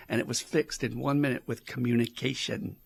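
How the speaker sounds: ordinary delivery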